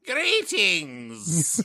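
booming echoing villain monologue. Pretend. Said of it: "I don't go quite as screechy".

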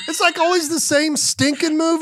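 high, laughing squeak; squeaky high voice. Real, not acted. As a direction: let the voice climb high and squeaky. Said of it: "how your voice ... squeaked".

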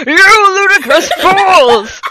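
high, very loud drawn-out yell; hammed-up outraged shout breaking into laughter; angry shouting. A narrator's reading. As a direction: bellow it theatrically, slowly. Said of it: "He kept shouting at us angrily".

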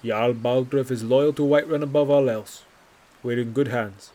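a touch of polished official tact; smooth, guarded official answer; diplomatic voice. Acted. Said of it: "in the same diplomatic voice".

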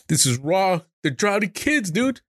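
choked up with upset; real distress climbing in pitch; emotional. Real, not acted. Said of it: "I'm gonna get emotional".

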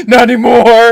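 loud shout through laughter; laughing shout. Real, not acted. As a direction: yell it, cracking up. Reacting to meme video.

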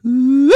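high, loud whistle of amazement; impressed whew. Retold or imitated. Host recalling the first bite, with an impressed high whew. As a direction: blow it out like the taste floored you. Tasting new sausage flavor.

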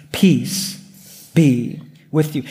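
a low, gentle Peace be with you. Acted. First words of risen Jesus.

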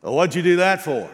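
a lightly voiced dad asking why you did that; imitating his dad. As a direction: scold like an exasperated dad. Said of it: "had one phrase".

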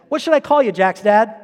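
put-on little kid voice. Boy asking his friend's dad what to call him, in a kid voice. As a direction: ask it like a polite small boy. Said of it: "I sound like I'm five".

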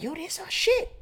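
high, soft squeaky excitement; giddy squeal. Retold or imitated. Walking into a surprise suite, high giddy squeal. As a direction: squeal it like a kid who just got lucky. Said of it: "I'm like".

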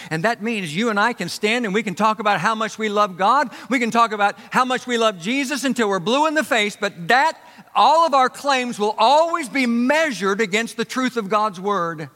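high, loud, driving sermon peak; forceful preaching. Real, not acted. Preacher on claims measured against God's word, forceful preaching. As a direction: drive the point home to the congregation.

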